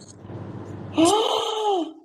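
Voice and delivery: high, sharp gasp that breaks into a cry; a real shocked gasp; sudden excited shriek of surprise; startled gasp-shriek. Real, not acted. The balloon moves on its own.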